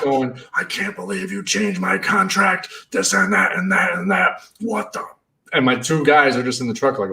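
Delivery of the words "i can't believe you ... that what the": high, slowed, indignant mock yell; retold angry yelling. Tent contractor yelling at the lying client. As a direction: rant at him like you're furious. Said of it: "I was screaming at him at the top of my lungs".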